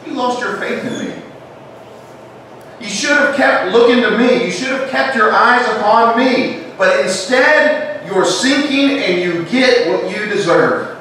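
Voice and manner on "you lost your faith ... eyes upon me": a hint of a harsh Jesus; stern rebuke. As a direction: pretend reproach that never happened. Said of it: "He did not say".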